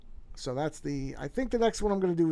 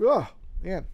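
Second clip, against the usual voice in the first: yawning; 4 semitones higher, 4 dB louder than usual. Real, not acted. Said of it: "sorry about yawning".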